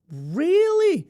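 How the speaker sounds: very high, drawn-out questioning squeak; hammed-up high-pitched surprise; mock astonishment. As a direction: act amazed, voice shooting way up. Interviewer shocked at answer.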